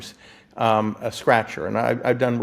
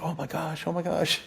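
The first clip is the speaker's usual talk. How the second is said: higher and softer, a gasp of disbelief; retold excitement; 6 semitones higher, 8 dB quieter than usual. Retelling winning fifty thousand, hushed higher excited gasp. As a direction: relive the thrill under your breath. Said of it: "So I was so excited".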